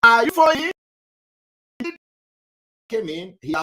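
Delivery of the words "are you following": higher, louder call to the class; loud check-in. Real, not acted. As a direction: jolt the class awake with the question.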